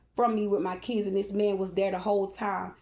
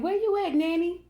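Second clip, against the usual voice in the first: calling niece; 9 semitones higher than usual. Retold or imitated. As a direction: call out high across the house. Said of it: "she said".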